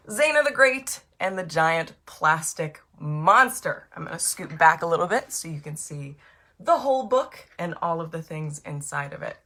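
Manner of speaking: plain reading voice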